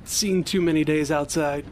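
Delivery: normal talking voice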